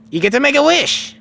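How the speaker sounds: put-on very high, loud kid voice; Goku. Goku explaining the wish, high and loud. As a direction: lift the voice high to announce it. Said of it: "He says".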